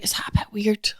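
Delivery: a hushed, whispered aside; a secret worried whisper; whispered worry. Retold or imitated. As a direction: whisper it as if to yourself. Worried inner thought, whispered.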